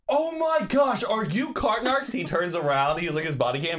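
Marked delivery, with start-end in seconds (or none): 0.1-2.1 much higher, excited fan shriek; starstruck squeal. Retold or imitated. Fan squealing at meeting the cart guy. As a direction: gush like meeting your idol.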